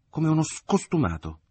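mother corrects herself, affronted yet dignified; with dignity. Acted. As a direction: correct the insult with wounded dignity. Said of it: "rettificò la madre con dignità".

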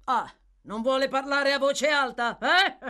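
loud, high jeer ending in a laughing "eh"; mocking exclamation. Magistrate Fang jeers at the silent prisoner, loud and mocking. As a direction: exclaim it loudly, scoffing at the silence. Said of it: "esclamò Fang".

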